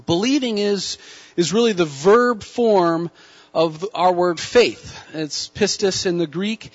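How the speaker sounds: normal talking voice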